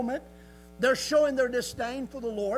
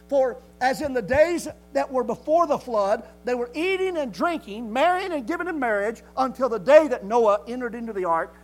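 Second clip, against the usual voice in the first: scripture proclaimed; 7 dB louder than usual. Acted. Quoting scripture with preaching force.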